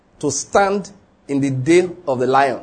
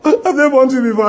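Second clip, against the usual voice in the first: laughing retort; 8 semitones higher, 5 dB louder than usual. Retold or imitated. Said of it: "And I say".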